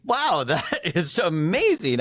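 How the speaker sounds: amazed, high, laughing it out; delighted disbelief with a laugh; laughing amazement. Retold or imitated. Host telling his co-host how amazed he was, laughing.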